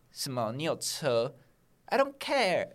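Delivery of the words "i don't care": dismissive. Pretend. Dismissive retort to bragging.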